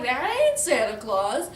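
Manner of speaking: loud, swooping cartoon voice; storybook pig exclamation. Pigs hear chimney noise, loud comic exclamation.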